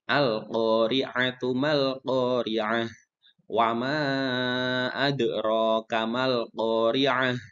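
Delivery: slow, drawn-out melodic tilawah; Quran recitation. Acted. Teacher reciting verses for students to follow, slow melodic chant. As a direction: chant each verse slowly and reverently.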